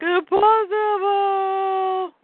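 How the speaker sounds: high, drawn-out comic yell; Superman's defiant cry. Acted. Clark answering the djinn, long hammed-up yell. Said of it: "And Clark's like".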